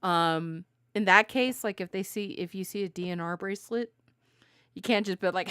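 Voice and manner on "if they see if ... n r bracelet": ordinary delivery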